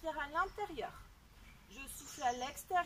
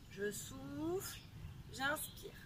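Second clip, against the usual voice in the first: slow breath cue; 5 dB quieter, slower than usual. Shown as a demonstration. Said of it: "coach paces the breathing".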